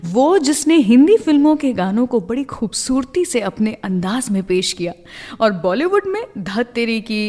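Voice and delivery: normal talking voice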